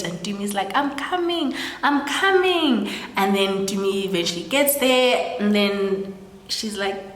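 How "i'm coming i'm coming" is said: high, put-on "hang on" holler; raised-pitch mock exasperation; impatient calling back. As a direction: imitate someone calling back while being rushed. Late partner calls back, acted.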